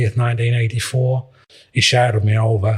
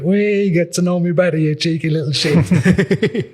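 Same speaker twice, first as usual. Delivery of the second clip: higher, laughing mock Clough, slowing down at the end; amused Brian Clough impression; 7 semitones higher, slower than usual. Manager laughing at the boy's cheek, higher mock impression. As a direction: play the manager chuckling at a cheeky kid.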